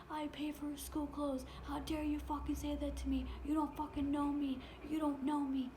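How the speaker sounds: high, loud retold tirade; imitated angry screaming. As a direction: mimic an outraged man yelling accusations. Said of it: "He's fucking screaming at me".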